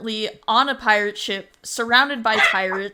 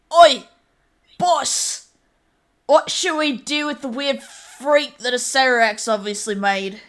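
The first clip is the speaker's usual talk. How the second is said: loud, higher rough pirate call; 4 semitones higher, 5 dB louder than usual. Acted. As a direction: shout up to the boss across the ship. Pirate calls out to his captain.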